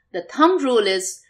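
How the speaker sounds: ordinary talk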